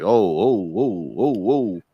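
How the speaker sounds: mock-startled run of whoas, jumping in pitch; imitated startled reaction. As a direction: imitate an onlooker crying whoa. Imitates onlooker's reaction in video.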